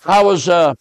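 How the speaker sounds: usual speaking voice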